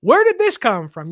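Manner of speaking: a kid whose friend likes the same crush, mock shocked. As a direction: play the betrayed teenager, caught off guard. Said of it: "And you're like".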